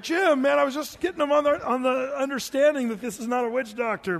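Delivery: high, loud imitated complaint; exasperated protest. Preacher retelling his complaint to Jim after the scare, exasperated. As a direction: scold a buddy in mock dismay. Retold scolding of prank-playing friend.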